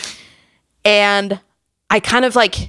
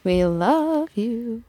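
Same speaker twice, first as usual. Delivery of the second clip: gushing; 3 semitones higher, 6 dB quieter, much slower than usual. Real, not acted. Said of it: "echoing gushing shout-out".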